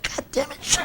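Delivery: hissed curse. Retold or imitated. Uncle cursing at nephew, soft exasperated hiss. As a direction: curse under your breath in dismay. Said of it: "I said".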